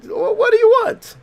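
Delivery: high, loud, desperate cry; shrill cry. Acted. Lonely date lashes out at his own reflection, high and loud. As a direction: throw the question out near breaking. Character lashing out in game dialogue.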